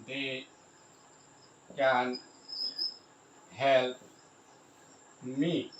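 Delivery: slow dictation, long gaps between words. Shown as a demonstration. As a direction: say each word on its own, then wait. Spelling out example while writing.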